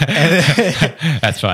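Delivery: amused, laughing through it. Real, not acted. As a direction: say it with a laugh still in it. Laughs, then repeats the words.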